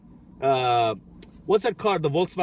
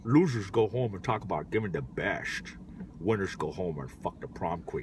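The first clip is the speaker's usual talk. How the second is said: Sean Connery; 11 semitones lower, 9 dB quieter than usual. Shown as a demonstration. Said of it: "do a really good Sean Connery".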